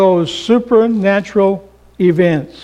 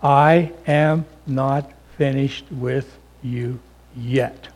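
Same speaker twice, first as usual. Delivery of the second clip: slow, soft and tender; God's gentle voice; 5 semitones lower, 6 dB quieter than usual. Acted. God to a despairing Elijah, slow soft reassurance. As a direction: reassure him quietly, word by word. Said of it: "He said".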